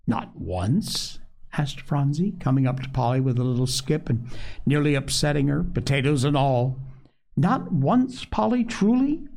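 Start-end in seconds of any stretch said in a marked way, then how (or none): 0.1-1.2 low-pitched, tentative question; quiet query. A narrator's reading. Child asks for confirmation. 7.4-9.3 put-on high-pitched, pleading little girl; eager child. A narrator's reading. Child presses eagerly for a promise.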